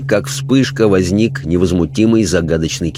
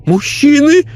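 much higher, louder stunned question; high, horrified lady's gasp; shocked lady; 5 dB louder than usual. A narrator's reading. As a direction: recoil at the word.

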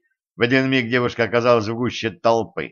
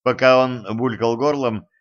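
ordinary delivery

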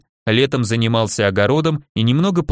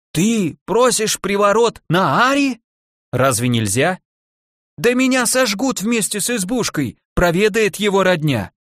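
put-on aghast old woman; 8 semitones higher than usual. A narrator's reading. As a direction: raise the pitch and draw out the shock.

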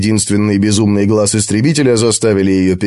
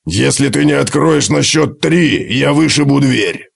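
threatening dwarf; 4 semitones higher than usual. Acted. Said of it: "пригрозил Готрек".

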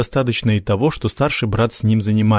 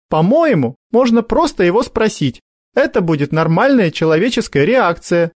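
put-on sensible girl's voice; 10 semitones higher, 3 dB louder than usual. Sonya reasoning.

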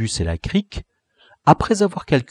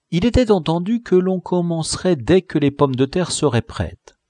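ordinary delivery

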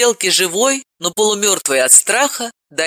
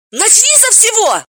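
very high, eager cry; excited exclamation; 4 dB louder than usual. A narrator's reading. Galina eagerly urging her friend, very high. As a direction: burst out with curiosity. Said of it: "с азартом воскликнула".

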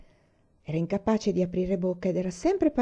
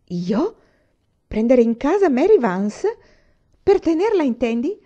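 much higher and louder, stunned disbelief; astonished; 6 semitones higher, 9 dB louder than usual. Acted. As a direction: cry out in amazement. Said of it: "la fissò sbalordita". (stupore sbalordito)

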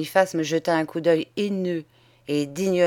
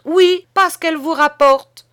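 much louder and higher; loud, higher repeated accusation; shouted repeat; 8 semitones higher, 8 dB louder than usual. A narrator's reading. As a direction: hammer the charge home.

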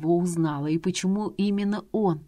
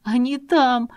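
frightened cry; 6 semitones higher, 6 dB louder, slower than usual. Acted. Frightened girl points to danger.

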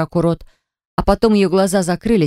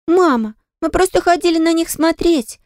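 put-on high little child's voice; little girl; 12 semitones higher than usual. A narrator's reading. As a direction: plead with mum like a small girl. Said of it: "попыталась защитить меня малышка". (голосом маленькой девочки)

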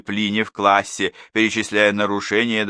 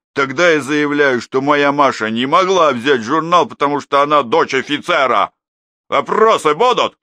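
higher, louder mocking declaration; sarcastic mother; 7 semitones higher, 8 dB louder than usual. A narrator's reading. Mother mocks the teacher at the meeting, loud sarcasm. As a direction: parody the teacher's logic.

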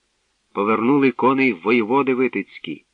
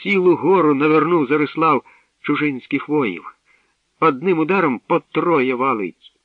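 high, excited battle report; thrilled, raised voice; joyful excitement; 5 semitones higher, 4 dB louder than usual. A narrator's reading. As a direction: gush with delight at the hero's feats. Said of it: "аж підскакуючи на могилі від радощів".

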